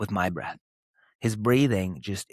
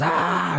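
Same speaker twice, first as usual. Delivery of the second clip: high, louder mock outburst of a kid; imitated frustrated yell; 9 semitones higher, 5 dB louder than usual. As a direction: let out a child's frustrated "Ah!". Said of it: "and he goes".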